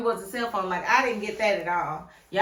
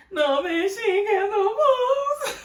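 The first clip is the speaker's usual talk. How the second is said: high crying wail, half laughing; fake-sob it in disbelief; laughing mock cry; 11 semitones higher, 5 dB louder, slower than usual. Mock despair at the TV.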